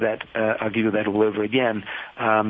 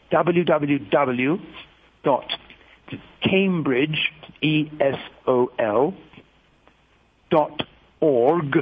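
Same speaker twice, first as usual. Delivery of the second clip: very slow, deliberate dictation; slow read-out so listeners can write it down; real slow; slower than usual. Real, not acted. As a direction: spell it out letter by letter with long pauses. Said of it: "I'll read it real slow".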